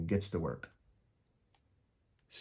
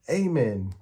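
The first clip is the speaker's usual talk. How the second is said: amen called out high and loud; hearty amen; 4 semitones higher, 6 dB louder than usual. A narrator's reading. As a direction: boom the amen. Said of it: "Peppermint Patty says".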